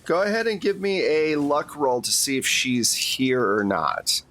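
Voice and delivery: usual speaking voice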